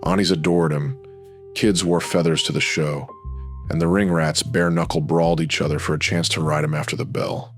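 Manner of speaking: ordinary talk, nothing marked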